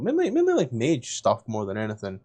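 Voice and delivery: normal talking voice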